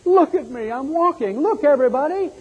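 high, thrilled cries of joy; ecstatic healed man. Acted. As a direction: crow with delight at walking again. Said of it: "He was excited".

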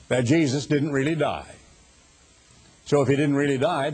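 ordinary delivery

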